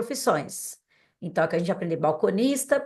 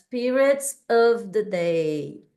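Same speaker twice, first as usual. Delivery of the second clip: slow model phrase; slower than usual. Shown as a demonstration. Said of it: "agora os períodos do dia".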